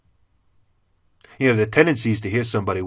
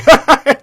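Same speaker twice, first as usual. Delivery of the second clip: mocking laugh; 12 dB louder than usual.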